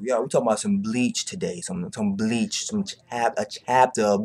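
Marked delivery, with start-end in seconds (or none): none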